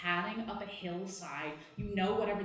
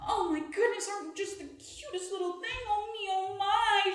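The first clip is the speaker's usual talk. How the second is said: put-on high-pitched cooing baby talk; singsong, squealing wizard delight; gushing wizard; 11 semitones higher, 3 dB louder than usual. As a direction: fuss adoringly over a tiny pet. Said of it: "and she goes".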